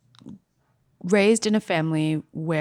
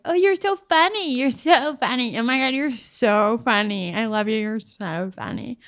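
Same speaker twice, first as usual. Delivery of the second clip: high, gushing mock praise, fading down; gushing friends; 6 semitones higher than usual. Friends mockingly gushing to the comic, high and fawning. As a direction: gush over her like an adoring friend. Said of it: "and they're like".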